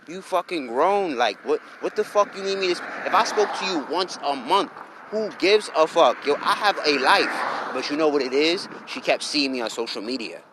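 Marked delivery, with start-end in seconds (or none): 0.1-7.5 exasperated telling-off. Retold or imitated. Man retelling how he told her she's grown, exasperated.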